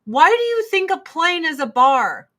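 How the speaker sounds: high indignant mock rant; mock outraged passenger. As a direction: blow up at the drinkers. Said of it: "they're like".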